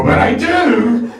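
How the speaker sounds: high, drawn-out shout; hammed-up triumphant yell; gleeful shout. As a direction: burst out with the confession, slowly and loudly. Gleeful confession after awkward admission.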